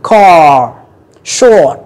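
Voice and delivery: car, short in an American accent with a strong R. Shown as a demonstration. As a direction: roll the R of American English at the end. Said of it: "kéo cái hàm dưới lại". (giọng Anh-Mỹ, uốn lưỡi âm R cuối)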